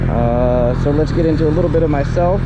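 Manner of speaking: muffled through helmet mic; talking into helmet mic while riding; inside a helmet. Real, not acted. Moto vlog recorded inside helmet.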